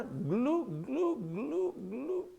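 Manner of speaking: mock glug glug of someone going under; playful sinking sound effect; sinking gurgle. Preacher imitating Peter sinking into the sea.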